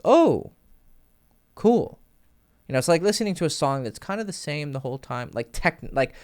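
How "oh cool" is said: impressed oh. Retold or imitated. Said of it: "and you're like".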